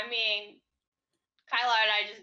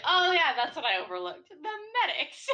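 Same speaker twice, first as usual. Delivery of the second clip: higher-pitched "oh yeah" of realizing; mock realization; 7 semitones higher, 3 dB louder than usual. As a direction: act out a sudden realization, pitch climbing at the end. Acts out own earlier realization.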